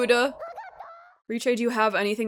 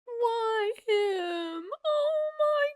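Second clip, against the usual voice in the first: crying; 12 semitones higher, much slower than usual. Real, not acted. Crying over companion.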